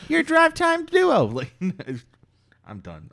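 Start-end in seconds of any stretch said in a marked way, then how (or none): 0.1-1.2 much higher, louder, slow DJ voice; shock jock radio voice. Pretend. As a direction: sell it like drive-time radio. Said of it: "my stupid shock jock radio voice".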